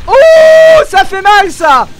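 long, very high "oh", then loud; loud cry. Real, not acted. Player reacting to a painful hit in the game, loud cry. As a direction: cry out high, easing down at the end.

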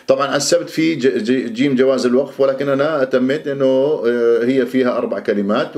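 ordinary talk, nothing marked

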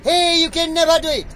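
loud, high-pitched scoff; mock scoffing. Reciting the poem's scoffer to his guest, loud mock scoffing. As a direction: jeer loudly like a scoffer. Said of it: "Somebody scoffed".

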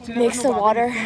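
ordinary talk, nothing marked

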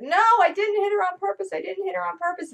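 put-on high child voice, defensive; four-year-old protesting. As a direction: play the little cousin pleading innocence. Voicing the four-year-old's protest.

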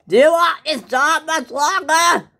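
very high, loud threatening shout; yelling boss. Acted. Boss threatening the worker's job, yelling. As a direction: yell it as a threat. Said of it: "he yells at me a lot".

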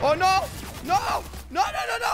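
high, frantic shouts of no; panicked shout. Real, not acted. Gamer yelling at an attacking enemy in panic. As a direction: yell no as the fight goes wrong.

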